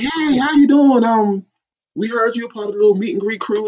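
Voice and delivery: friendly greeting. Retold or imitated. Voicing Renee greeting a kid.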